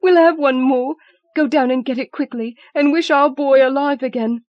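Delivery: very high, loud triumphant urging; wife urging husband to wish their boy alive. A narrator's reading. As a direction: press him on, exultant and urgent. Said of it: "she cried triumphantly".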